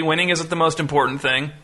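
ordinary delivery

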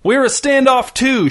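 shouting the hostage's name across the lawn. A narrator's reading. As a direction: call it out to the cops, drawn out. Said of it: "shouted Ray".

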